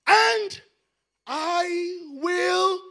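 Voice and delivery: high, loud, slow shouted promise; booming scripture quote. Acted. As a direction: proclaim it like God speaking from heaven. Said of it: "So the Bible says".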